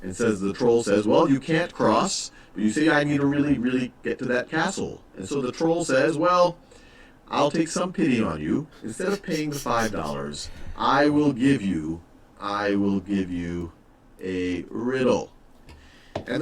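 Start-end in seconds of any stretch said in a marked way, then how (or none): 1.1-5.0 lightly voiced troll. Teacher voices troll and traveler. 6.2-15.3 lightly voiced troll. Teacher voices troll in story.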